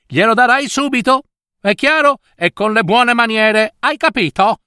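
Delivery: high-pitched, insistent instructions; bossy mother. Acted. Mother sending Cecilia to the fountain, insistent. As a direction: drum the orders into a daughter.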